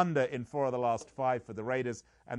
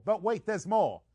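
higher and louder hyped pitchman voice; infomercial pitchman; 9 semitones higher, 8 dB louder than usual. Acted. Host hams up a TV ad pitch for the betting site, hyped pitchman. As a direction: sell it like a late-night TV ad.

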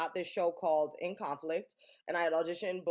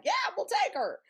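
quoted teacher. Retold or imitated.

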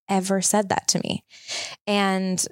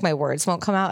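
normal talking voice

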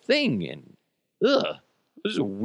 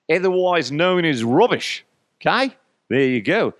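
loud, hammed-up Australian accent; 5 semitones higher, 5 dB louder than usual. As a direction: belt out a mock Australian translation. Putting on Australian for local word.

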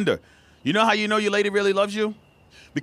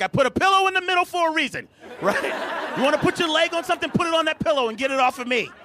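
annoyed partner; 8 semitones higher than usual. Retold or imitated. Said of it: "They're like".

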